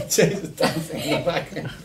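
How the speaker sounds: words broken up by loud laughter; high, loud, talking through a laughing fit; laughing hard. Real, not acted. Colleague spotting dancing in the background, laughing hard.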